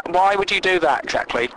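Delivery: ordinary delivery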